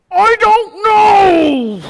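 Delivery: put-on very high, loud, slow kid's wail; wailing child. Son wailing at his dad, very high, loud and slow. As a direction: stretch it out like a frustrated little boy howling. Son answering dad's question.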